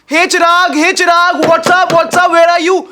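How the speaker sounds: loud, high imitated greeting; calling out. Party guests arriving and looking for Chirag, calling out. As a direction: holler boisterously for a friend.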